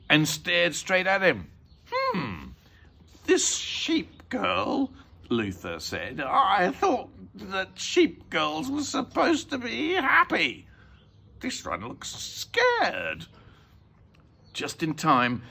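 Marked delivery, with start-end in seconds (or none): 1.9-4.9 high, very slow crook voice; suspicious thief. A narrator's reading. Crook inspects the painting suspiciously. 6.2-13.3 slow, high crook voice, dropping at the end; drawn-out, theatrical robber; suspicious thief. A narrator's reading.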